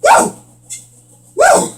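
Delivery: very high, loud woo; whoop. Real, not acted. Gamer psyching himself up, whooping. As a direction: whoop to pump yourself up.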